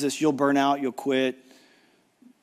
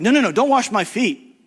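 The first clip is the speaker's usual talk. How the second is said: Peter protesting; 8 semitones higher, 5 dB louder than usual. Retold or imitated. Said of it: "He's like".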